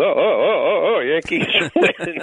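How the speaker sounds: slow, drawn-out stadium call with a laugh; John Sterling's home run call. Retold or imitated. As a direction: stretch out each oh like a radio call. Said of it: "they'll be playing".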